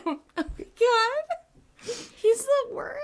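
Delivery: high, laugh-cracked squeal; laughing hard. Real, not acted. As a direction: crack up while talking.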